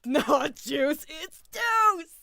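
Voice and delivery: high, very loud catchphrase yell; excited shout. Retold or imitated. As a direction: shout the iconic line with gusto. Shouts along with iconic line.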